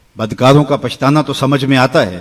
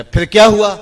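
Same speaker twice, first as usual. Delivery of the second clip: high, louder curious asking; eager question; 9 semitones higher, 5 dB louder than usual. Retold or imitated. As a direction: press him to go on. Passerby asks the crying beggar.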